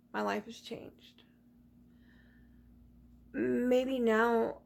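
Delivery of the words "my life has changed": whispered realization. Real, not acted. Emotional reflection.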